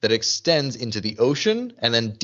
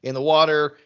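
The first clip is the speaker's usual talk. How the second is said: higher, louder imitation of panic; mock freak-out; 5 semitones higher, 5 dB louder than usual. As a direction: mimic someone freaking out, half in earnest. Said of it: "Shannon freaks out 'cause she's like".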